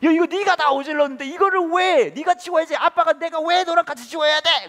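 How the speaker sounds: very high, loud ranting yell; even angrier dad. Retold or imitated. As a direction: rant at the child in outrage. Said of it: "얘한테 더 화가 나가지고". (더 화가 난 아빠가 고래고래 소리치듯)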